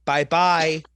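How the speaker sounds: loud, gleeful send-off; mocking farewell. Real, not acted. Speaker jeering an old quarterback into retirement, mocking farewell. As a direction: wave him off with a loud bye-bye.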